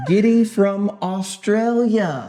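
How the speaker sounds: hammed-up Aussie greeting, stretched out slowly; Australian accent. Reading a viewer's greeting from Australia, mock Australian accent. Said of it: "That was my best Australian accent".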